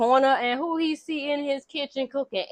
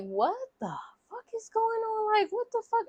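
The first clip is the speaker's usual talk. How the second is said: high, softer incredulous voice; bewildered disbelief; 11 semitones higher, 8 dB quieter than usual. Retold or imitated. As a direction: imitate a man utterly baffled, pitch up high. Retold bewilderment at her wild claim.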